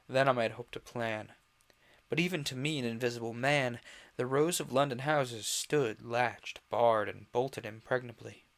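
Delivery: emphatic, projected audiobook narrator; story narration. A narrator's reading. Invisible Man recounting his wretched winter, projected storytelling. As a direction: tell the tale with dramatic, deliberate phrasing.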